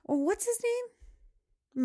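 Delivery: put-on high, drawn-out kid question; imitating little daughter. Daughter asks mom about the buffalo's name, imitated high and drawn out.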